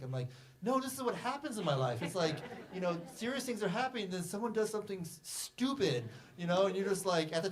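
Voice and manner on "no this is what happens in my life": insistent protest. Retold or imitated. Quoting his protest to script readers.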